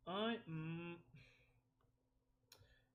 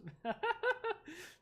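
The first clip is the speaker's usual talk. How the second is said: high, loud laugh; burst of laughter; 4 dB louder than usual. Real, not acted. Streamer cracking up at a chat joke, high laughter. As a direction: crack up suddenly.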